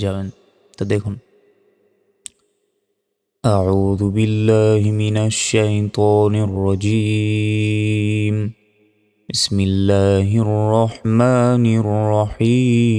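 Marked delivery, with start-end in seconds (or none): 3.4-12.9 very slow, melodic tajweed chant; measured, sustained recitation; Quran recitation. Shown as a demonstration. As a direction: recite the opening with long held vowels.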